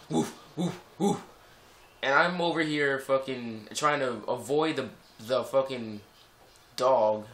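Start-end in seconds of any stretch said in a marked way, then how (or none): 0.1-1.2 dog bark imitation. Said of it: "a dog comes out".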